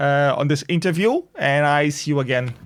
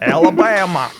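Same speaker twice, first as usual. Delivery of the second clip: Alabama accent; 3 semitones higher, 4 dB louder than usual. Shown as a demonstration. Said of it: "I know how to do Alabama accent".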